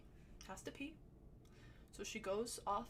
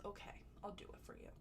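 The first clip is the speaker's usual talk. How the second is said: quick whisper; 10 dB quieter, faster than usual. Retold or imitated. Lamar agrees to share blunt.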